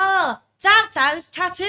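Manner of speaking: slow, high, loud British outburst; loud British exclamation. Retold or imitated. Spotting a tattoo sign mid-walk, loud exclamation. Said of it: "me, in all my Britishism and well-known loudness".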